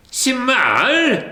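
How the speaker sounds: high, sharp disbelieving question; indignant surprise. A narrator's reading. Scrooge objecting to the ghost, high and incredulous. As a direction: throw the word back at him.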